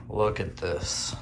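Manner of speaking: ordinary talk, nothing marked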